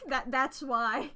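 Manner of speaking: real laughter between loud bursts of words; laughing hard. Real, not acted. Streamer joking about an accidental reveal, laughing loudly. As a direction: crack up while getting the words out.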